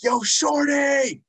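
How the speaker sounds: high, drawn-out playful shout. Shown as a demonstration. Host shouting out the guest's rap name, high drawn-out shout. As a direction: call the name out long and high. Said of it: "like, shout out to you".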